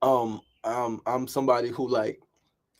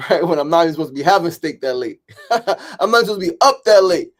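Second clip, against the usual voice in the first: laughing; 4 semitones higher, 7 dB louder than usual. Real, not acted. Laughing at fond dad memory.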